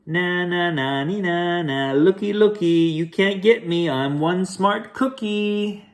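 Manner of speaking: high singsong taunting chant; cookie taunt. Acted. Cookie taunts the chasing bad boys in high singsong. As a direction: tease them like a cheeky runaway.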